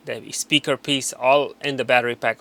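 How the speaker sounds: ordinary talk, nothing marked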